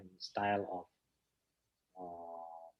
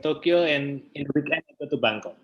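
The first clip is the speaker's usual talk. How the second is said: laughing; 6 semitones higher, 4 dB louder, faster than usual. Real, not acted. Answering while still laughing.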